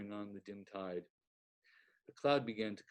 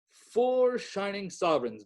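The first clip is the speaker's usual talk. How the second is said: high, loud delighted cry; cried with delight; 9 semitones higher, 8 dB louder than usual. A narrator's reading. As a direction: crow over the money. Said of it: "cried with delight".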